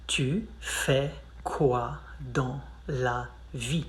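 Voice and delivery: slow, soft and drawn out; slow it down for learners; slow careful demo; slow model. Shown as a demonstration. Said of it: "We ask".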